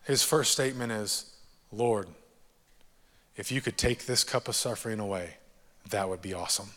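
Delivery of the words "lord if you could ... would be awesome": quiet, pleading prayer to the Lord; soft prayer. Acted. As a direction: murmur it gently, fading almost to nothing. Quoting Jesus' prayer softly.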